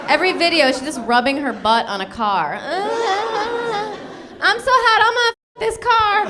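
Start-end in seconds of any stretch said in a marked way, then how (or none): 2.6-6.3 breathy sexy pop-star pose; mock pop diva. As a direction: purr it like a music video. Mocking [name] video.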